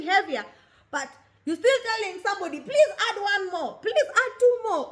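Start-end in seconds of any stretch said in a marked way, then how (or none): 2.6-4.9 pleading for more. Retold or imitated. Greedy person asking for more.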